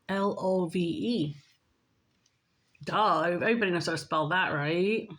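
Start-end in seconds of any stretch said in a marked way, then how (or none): none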